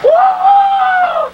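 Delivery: high, loud gleeful whoop; excited whoop. Acted. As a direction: cheer the train on. Child cheering the fast train.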